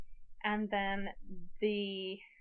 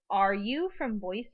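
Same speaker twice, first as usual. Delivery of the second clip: slower, rising into a question on "Boise"; 4 dB louder, slower than usual. Shown as a demonstration. Teacher turns a sentence into a question, asking tone. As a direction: ask it, lifting "Boise" at the end. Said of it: "the next question I could say is".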